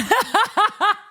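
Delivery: high-pitched giggle burst; high, tickled laugh. Real, not acted. Laughing at the name Tiger Lily.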